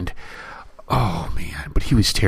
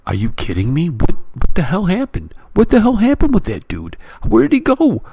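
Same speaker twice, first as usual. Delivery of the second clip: rising higher and louder with each question, near shouting; exasperated outburst; 10 semitones higher, 3 dB louder than usual. Retold or imitated. Ranting at a film's ending, growing incredulous and loud. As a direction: climb in pitch with disbelief until it nearly cracks. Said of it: "I was just so angry".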